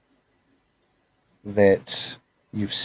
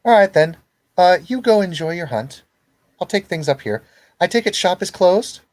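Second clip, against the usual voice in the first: high, breezy sweet-talk; high spider lady; 9 semitones higher, 4 dB louder than usual. Acted. Devora sending her daughter off to hunt, high and sugary. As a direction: sing it out sweetly and airily. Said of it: "she says".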